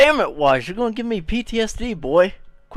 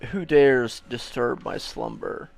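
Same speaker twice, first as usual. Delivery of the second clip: mock monster voice; 17 dB louder, slower than usual. Streamer mimics a woken monster.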